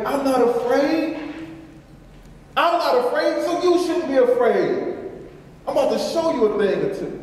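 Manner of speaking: a bold Jesus comforting his followers; Jesus speaking. Retold or imitated.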